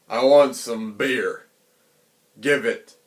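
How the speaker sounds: bandit demands beer. Retold or imitated. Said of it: "then he's like".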